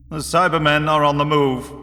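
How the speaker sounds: flat statement. A narrator's reading. Vorus breaks the news to his engineer, flat statement. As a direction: state it flatly, without flourish. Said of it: "Vorus said flatly".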